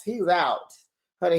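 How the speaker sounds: ordinary talk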